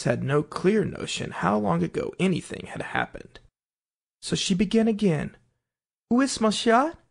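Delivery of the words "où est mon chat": French phrase. A narrator's reading. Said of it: "first sentence in her French lesson book".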